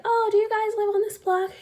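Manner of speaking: high-pitched imitation of a curious lady; chatty neighbor lady. Lady asks trick-or-treaters about their block, high curious imitation. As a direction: ask lightly in a high neighborly voice. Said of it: "this lady asked".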